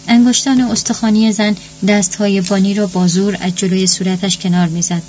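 ordinary delivery, nothing marked

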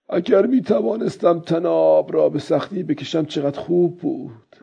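put-on old man thinking to himself. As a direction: think it through aloud as the old fisherman.

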